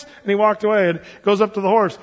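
ordinary delivery

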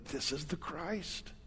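soft, slow and low confession; hushed confession. Acted. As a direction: say it almost under your breath, reverently. Few people quietly confess it.

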